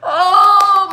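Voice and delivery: loud, high, drawn-out "oh"; mock wail. Father grieving his favorite son's lost blessing, loud mock wail. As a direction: cry out in hammed-up grief. Father wails over favourite son.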